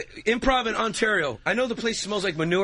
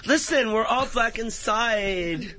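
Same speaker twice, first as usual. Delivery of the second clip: put-on higher, slow, drawn-out girly voice; earnest girl; 4 semitones higher, much slower than usual. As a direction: lecture them as an over-earnest girl. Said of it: "You always get that one girl".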